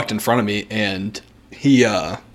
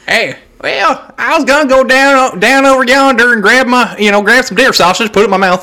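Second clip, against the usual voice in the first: put-on Southern accent; 10 semitones higher, 4 dB louder than usual. Mocking old classmates, a high Southern accent. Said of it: "when did you start talking like that".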